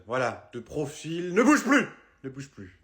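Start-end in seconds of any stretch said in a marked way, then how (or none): 1.3-1.9 cri. Acted. Peintre crie sur le client. 2.2-2.8 murmuré. Acted. Répété tout bas.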